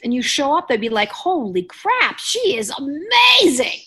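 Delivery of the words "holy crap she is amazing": high, slow, gushing mock amazement; amazed gasp. Date meeting her in person, high amazed mock gush. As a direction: play the stunned date who can't believe her luck. Imagined dater's amazed reaction.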